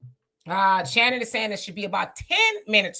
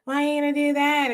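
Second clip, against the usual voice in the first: imitating her; 4 semitones higher than usual. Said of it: "She goes".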